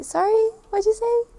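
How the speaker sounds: high, rising polite query; polite asking. Shown as a demonstration. Visitor who didn't hear someone, polite asking. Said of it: "proper or common to say".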